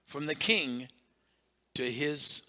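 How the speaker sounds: normal talking voice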